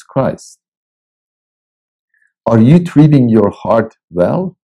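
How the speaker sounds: slow, deliberate reading from his book; devotional reading. Acted. Preacher reads his devotional book to listeners, slow and measured. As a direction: read each line slowly and let it land.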